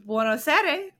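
lightly imitated Italian accent; Italian accent attempt. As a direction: try an Italian greeting, imitating the man. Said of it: "in Italian".

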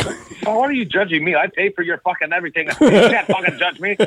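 high, rising into a shout; mock outrage. Dad snapping back at his teasing daughter, rising to a shout. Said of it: "I'm like".

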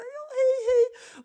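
very high, squeaky hellos; awkward greeting. Retold or imitated. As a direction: greet like a flustered parent. Mother greets art teacher awkwardly. (pinsam, väldigt hög hälsning)

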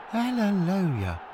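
high, slow hallelujah; gleeful praise; joyful hallelujah. Acted. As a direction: celebrate like a revelation. Joyful exclamation.

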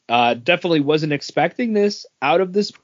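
ordinary delivery

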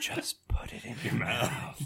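whisper. Pretend. Joking whisper about tasting.

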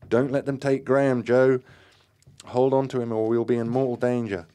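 high, loud warning; urgent shout. A narrator's reading. Group member warns Joe of danger, urgent shout.

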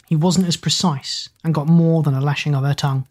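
usual speaking voice

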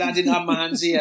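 loud protesting high voice; indignant denial. Retold or imitated. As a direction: protest too much. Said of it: "He said".

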